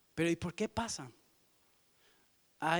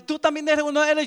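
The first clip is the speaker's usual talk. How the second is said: accusing; 6 semitones higher, 9 dB louder, faster than usual. Acted. Accuser confronting Peter.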